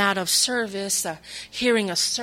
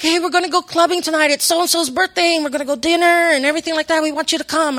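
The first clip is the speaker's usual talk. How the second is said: higher, louder, fast party invite; excited friend; 8 semitones higher, 9 dB louder, faster than usual. Retold or imitated. Friend on the phone inviting her out clubbing, excited and fast. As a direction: hype her up to come clubbing.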